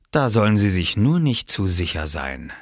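ordinary delivery

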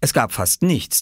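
usual speaking voice

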